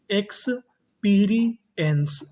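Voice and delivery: Experience drawn out very slowly, syllables apart. Shown as a demonstration. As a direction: spell it out slowly for the class. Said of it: "तो meaning क्या बनी". (धीरे-धीरे शब्दांश तोड़कर)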